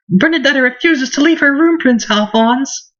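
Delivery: high-pitched character voice; game girl reporting. Acted. Girl tells Prince Alphonse about Bernadetta, high character voice.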